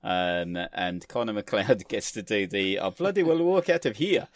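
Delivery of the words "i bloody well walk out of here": hammed-up bad Scottish accent, higher and louder; a deliberately terrible Scottish accent; worst Scottish accent ever. Recalling the film line in a bad Scottish accent. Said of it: "the worst Scottish accent ever".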